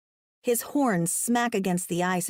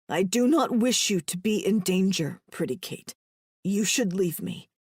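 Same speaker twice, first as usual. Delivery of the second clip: weak injured man. Acted. Herrick's voice in dialogue.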